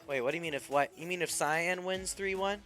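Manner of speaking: ordinary talk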